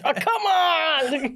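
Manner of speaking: drawn-out exasperated groan; slow groan. Retold or imitated. Driver stuck in the snow groaning slowly at herself. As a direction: stretch it out in disbelief.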